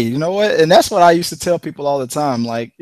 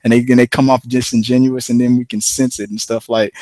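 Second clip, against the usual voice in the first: usual speaking voice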